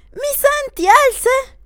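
high, loud worried aunt; aunt pleading for an answer; the aunt, anxious. Acted. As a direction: call to the fainted girl in alarm. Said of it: "la zia".

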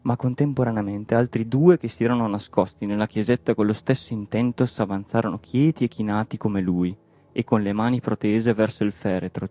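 plain reading voice

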